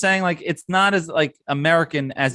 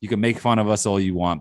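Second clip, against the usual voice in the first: normal talking voice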